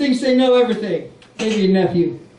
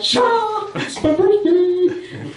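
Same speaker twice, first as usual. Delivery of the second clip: put-on whiny teen; 9 semitones higher, much slower than usual. Teen self voiced.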